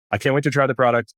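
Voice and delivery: normal talking voice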